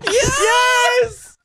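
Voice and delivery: very high, loud scream of joy; triumphant shout. Real, not acted. Player celebrating the win, triumphant shout. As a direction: shriek it in triumph.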